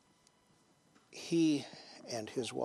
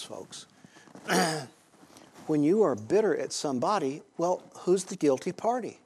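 ordinary talk, nothing marked

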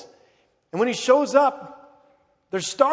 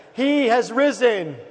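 raised, slow congregational call; Easter proclamation; 4 dB louder, slower than usual. Shown as a demonstration. Pastor trying again with the eleven o'clock crowd, raised proclamation. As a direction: call it out to draw a response. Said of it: "So let's try again".

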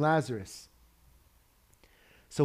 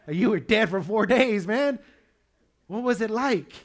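high, incredulous questions with rising excitement; amazed questioning; 8 semitones higher, 6 dB louder than usual. Acted. Dinner guest asking Lazarus about being dead, amazed. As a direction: press him like an astonished dinner guest.

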